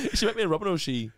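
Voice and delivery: high, laughing through the words; giggling disbelief. Real, not acted.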